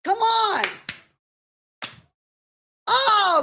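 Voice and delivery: high pleading come on; excited cry. Real, not acted. Fan shouting at the match, high excited plea. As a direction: urge her on, way up high.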